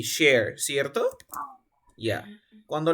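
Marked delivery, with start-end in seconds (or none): 0.0-0.6 "share" said loud and firm, ending on R; teacher presenting "share" clearly; "share" with a held R and no extra syllable; model pronunciation. Shown as a demonstration. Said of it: "Esto se pronuncia así".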